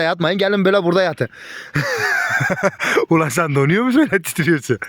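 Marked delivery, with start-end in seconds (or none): 1.5-3.1 laughter squealing way up; high squeal of laughter. Real, not acted. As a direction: burst into a high giggle. Laughing at the shivering dog. (ince, tiz kahkaha)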